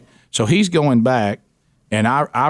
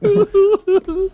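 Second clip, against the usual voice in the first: high squealing burst of laughter; laughing. Real, not acted. Telling the prank story, bursts out laughing. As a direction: crack up at the prank.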